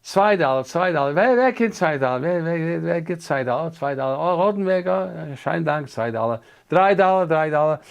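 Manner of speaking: high, loud, rolling bid-calling; imitated auction chant, rising to the next bid; funny Yiddish auctioneer. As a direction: work the room like a Yiddish auctioneer. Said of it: "it was a funny Yiddish auction".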